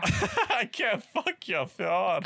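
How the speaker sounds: repeats the line high and slow, laughing through it. Real, not acted. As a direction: crack up while echoing the words. Repeats friend's garbled phrase laughing.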